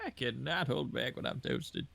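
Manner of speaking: very slow mock Southern drawl. Friends riff on the old Southerner, slow hammed-up Southern drawl. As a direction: drag each word out in Southern.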